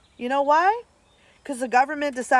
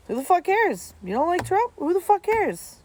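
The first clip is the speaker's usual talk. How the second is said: high, incredulous venting; exasperated outburst; 9 semitones higher than usual. Real, not acted. Streamer ranting to chat about politics, high exasperated outburst. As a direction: fling it out in disbelief.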